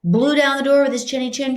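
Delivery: hammed-up example of what not to say; overblown example quote. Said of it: "Don't try to say".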